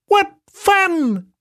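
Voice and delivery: very high, cheeky monkey; squeaky monkey voice. Acted. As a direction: play a tiny chattering monkey. Monkey speaks in the story.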